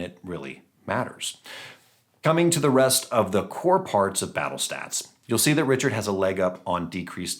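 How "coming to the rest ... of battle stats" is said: normal talking voice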